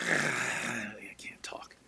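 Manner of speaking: breathy voiceless rasp, barely there; voiceless whisper. Retold or imitated. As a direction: whisper like a throat just freed of a tube. Intubated patient can't speak.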